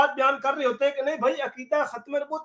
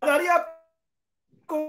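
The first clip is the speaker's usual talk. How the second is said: choppy broken call audio; call dropping out; much slower than usual. Real, not acted. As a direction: voice cutting in and out. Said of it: "cut cut के आई है". (आवाज़ कटती हुई call)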